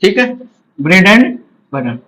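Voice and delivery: ordinary talk, nothing marked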